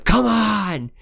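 high, drawn-out retold yell; imitating a desperate high-pitched shout; Ric Flair. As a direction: yell it like a wrestler egging on his opponent. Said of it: "Rick just fucking yells at him".

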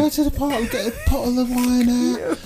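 high, slow put-on lady voice; sing-song posh picnic lady; high mock voice. Imagining the woman's day.

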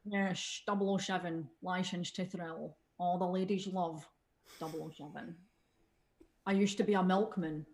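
Scottish spy with shushed s sounds; Sean Connery. Acted. Playing the double oh seven star, shushed Scottish voice. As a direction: play a suave Scottish spy, s turned to sh. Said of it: "then we have our Sean Con".

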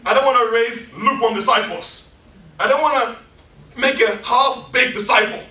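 rally disciples like a prophet on fire; zealous Elisha. Retold or imitated. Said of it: "Elisha was like".

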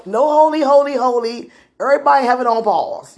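hammed-up cry to stop the heavenly choir; excited interruption. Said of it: "I was like".

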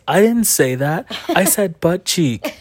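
put-on high-pitched child's protest; imitated oldest daughter. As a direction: protest like a kid correcting a parent. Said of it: "our oldest daughter says".

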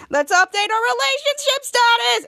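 loud, very high burst of glee; excited squeal. Retold or imitated. Bianca to Henry, now a couple, excited squeal.

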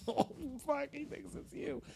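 high, hushed dismay; dismayed God. Retold or imitated. God realizing he's blamed, hushed alarm. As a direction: gasp it out under your breath. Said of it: "And he's like".

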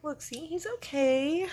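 put-on high, slow, reassuring baby talk; gentle baby talk. Grandma reassuring toddler his baby brother is fine, high, slow and gentle. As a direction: soothe a worried little boy.

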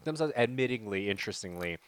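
usual speaking voice

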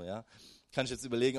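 normal talking voice

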